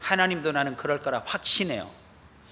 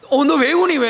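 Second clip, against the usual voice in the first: higher, louder, put-on innocent question; mother's fake concern; 10 semitones higher, 8 dB louder than usual. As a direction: play the mom feigning surprise her child cries. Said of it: "엄마가". (아이에게 모르는 척 묻는 엄마, 높고 크게)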